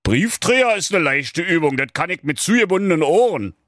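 proud wizard. Acted. Wizard proudly accepts the dog's challenge. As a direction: toss it off proudly. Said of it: "Der Zauberer sagte stolz".

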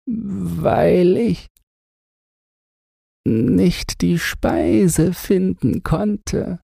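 very high, slow, frail voice straight into the ear; pointed lips, each word drawn out like a last breath; dying man's confession. A narrator's reading. Starving man's last words into the overseer's ear, high and slow. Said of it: "sprach mit wie zum Kuss gespitzten Lippen gerade in das Ohr".

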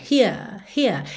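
Here said with a long vowel and no R; non-rhotic Here. Shown as a demonstration. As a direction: stretch the vowel in Here and drop the R. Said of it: "alongamento da vogal". ("Here" com vogal longa, sem R)

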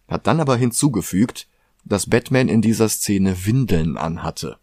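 normal talking voice

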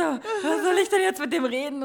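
high, loud, laughing fake despair; laughing mock panic. Mocking her own small-talk panic to friends, laughing high. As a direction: squeal it through laughter like a flustered kid.

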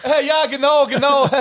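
high, flustered embarrassed agreement; awkward. Retold or imitated. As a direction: play along, embarrassed and caught out.

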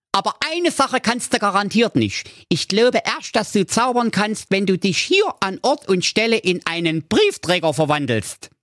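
high, cheeky boot-wearing dog voice; cheeky dog character. A narrator's reading. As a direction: goad the wizard with a high voice. Dog sets the wizard a trap.

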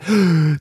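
sharp mock gasp of panic; panicked gasp. Trainer acting out someone panicking at approaching men, mock panicked gasp. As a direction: gasp as if panic suddenly hits. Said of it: "ich werd grad total panisch".

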